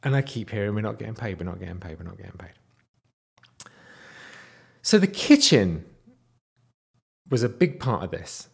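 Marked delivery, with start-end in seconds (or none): 0.7-2.5 low repeated mutter, fading away; imitated murmured grumbling. Teller echoing unpaid workers overheard on site, imitated murmuring. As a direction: imitate background grumbling, sinking softer each time.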